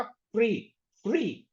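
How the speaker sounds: फ्री spoken as kri, k and ri fused; फ्री with tight cluster. Shown as a demonstration. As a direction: demo of the fused syllable. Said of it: "का और री मिलके बना क्या?".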